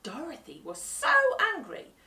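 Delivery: ordinary delivery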